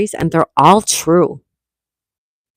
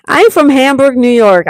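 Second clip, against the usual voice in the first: loud, raised-pitch insistence; real excitement; 6 semitones higher, 5 dB louder than usual. Real, not acted. Streamer repeating own hometown to Ann after laughing, excited. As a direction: exclaim it, delighted by the coincidence.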